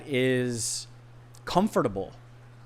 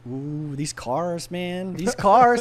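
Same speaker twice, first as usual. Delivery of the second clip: drawn-out, slow wonder at the cars; slow, awestruck car-lover excitement; stoked; 4 semitones higher, much slower than usual. Retold or imitated. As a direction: relive being thrilled, stretching the words out. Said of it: "like, I'm stoked".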